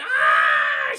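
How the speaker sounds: long, loud imitated scream; falling scream. Demona falling from the castle wall, screaming. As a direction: scream like someone plummeting.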